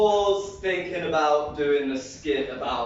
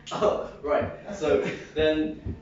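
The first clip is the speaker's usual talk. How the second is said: ordinary talk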